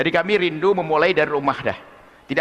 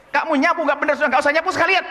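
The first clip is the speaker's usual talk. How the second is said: scolding mother; 11 semitones higher, faster than usual. Retold or imitated. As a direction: snap at the child in disgust.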